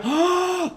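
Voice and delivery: high hammed-up gasp of awe; smitten gasp. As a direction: gasp as if seeing a queen.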